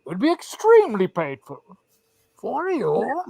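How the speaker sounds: theatrical masked-villain voice; mock-menacing movie villain impression; Bane. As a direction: put on the villain's voice with swooping pitch. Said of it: "But he's like".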